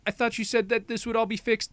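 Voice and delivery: put-on high-pitched complaining girl; mock upset. Rumi confronts the head lady, mock upset.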